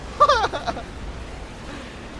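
high, wheezy laugh. Real, not acted. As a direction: burst into high airy laughter. Reacts during the race.

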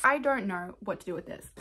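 ordinary talk

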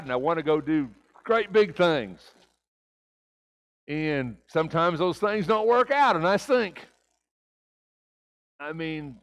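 high, loud pulpit confession; preacher confessing to the congregation at full force; fervent preaching. Acted. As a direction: preach it out hard, owning it.

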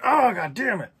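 retold cry of frustration, high and loud; loud, high-pitched exasperated curse; upset outburst. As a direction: burst out in dismay, imitating a friend. Friend reacts to the layoff news.

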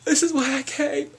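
very high, slow, loud squeal; swooning squeal. Retold or imitated. Fan seeing the idol, swooning squeal. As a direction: squeal it out in rapture. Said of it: "I was like".

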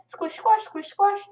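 loud, high squish-squash chant; playful high blob sound effect; imitated blob squishing. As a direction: squeal the squelches in bouncy rhythm.

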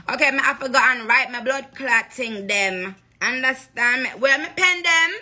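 playful Jamaican patois voice. Acted. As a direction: slip into a breezy Jamaican patois.

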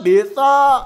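sudden loud call of surprise; startled shout at the buffalo. Real, not acted. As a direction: yell the name out as it appears. Spots the buffalo, calls out.

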